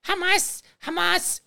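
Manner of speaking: Hamas squeaked up high, mocking the congresswoman's way of saying it; mocking pronunciation. Host parodies a congresswoman saying Hamas, in a high squeak. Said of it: "going Elon and Hamas".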